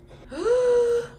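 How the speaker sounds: big, high, voiced gasp; loud intake of delighted shock; excited gasp. Real, not acted. Viewer thrilled by Monica's appearance, gasping loudly. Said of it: "Sorry, I'm excited, guys".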